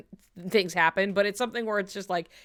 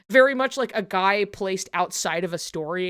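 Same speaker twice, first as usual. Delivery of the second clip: normal talking voice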